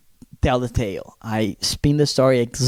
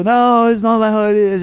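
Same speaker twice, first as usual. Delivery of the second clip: high, loud whine of denial; mock wailing protest; 8 semitones higher, 5 dB louder than usual. Beaten son denying it to his father, mock wail.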